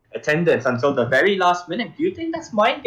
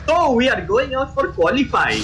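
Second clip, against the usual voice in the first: put-on high, brief Indian accent joke; quick Indian accent for one line; 11 semitones higher than usual. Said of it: "I should probably talk in an Indian accent".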